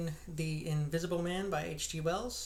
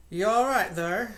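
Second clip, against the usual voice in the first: loud, higher call through a closed door; 5 semitones higher, 7 dB louder than usual. Acted. Innkeeper calling through the parlor door, loud. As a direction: rap and call out to the room. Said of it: "asked Hall, rapping".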